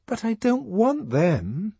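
high, slow, drawn-out refusal; plaintive objection. A narrator's reading. Lenina refusing Fanny's other men, plaintive. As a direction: lean on each word in complaint.

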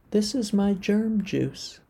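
very high, slow tiny germ voice; little germ voice. A narrator's reading. The little germ explaining its juice, high and slow. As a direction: proudly present it like a child. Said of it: "said the little germ".

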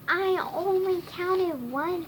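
high-pitched mild whine; whining. A narrator's reading. Snitch defends himself to Wizard, mildly whining. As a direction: protest in a high voice. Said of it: "Snitch whined".

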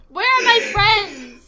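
put-on drunken wail. Drunk character wailing, laugh-crying.

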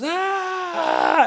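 high, drawn-out mock scream; long high "aah" yell, acted out; retold scream. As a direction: imitate a man screaming from a lightning shock. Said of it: "and he screams".

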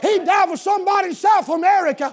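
loud, high sermon climax shout; full-force preaching. Real, not acted. Preacher to congregation on Jesus dying for the whole world, full-force preaching. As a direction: shout it out, each phrase driven hard.

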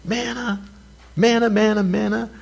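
high, sing-song mock whine of manna; sing-song mock complaint. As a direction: drone the word like a bored child. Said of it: "That's what they said".

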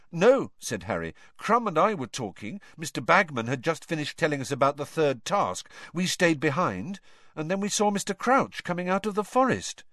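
put-on young Harry. A narrator's reading. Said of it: "Harry answers".

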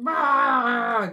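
a loud mock "Bah!" outburst; mock monster roar. Psycho girlfriend snapping "Bah!" at a sleepy boyfriend. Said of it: "go psycho girlfriend on them".

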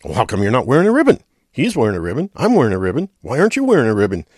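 mock badgering, rushed; insistent marcher.

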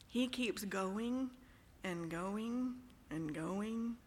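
lingering, long-drawn delivery; drawn-out repetition. Real, not acted. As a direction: drag each "going" out as if it never ends. Mimicking Paul's endless run-on writing.

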